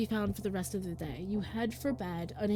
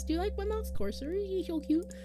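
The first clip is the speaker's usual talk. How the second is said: cutesy gushing; 10 semitones higher than usual. Real, not acted. Showing off cute mouse.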